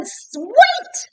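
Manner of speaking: high, loud shout to stop him; sudden high shout. Acted. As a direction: yell wait before the door shuts. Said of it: "And Elizabeth shouted".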